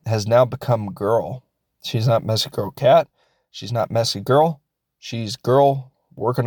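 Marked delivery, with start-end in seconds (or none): none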